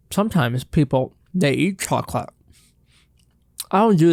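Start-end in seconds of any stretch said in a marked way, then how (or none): none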